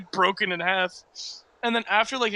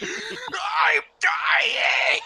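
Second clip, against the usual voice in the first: put-on high, crying childish shout; mock crying wail. Child running to mom after falling, high crying wail. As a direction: cry it out like a terrified child. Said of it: "I'm crying and hyperventilating".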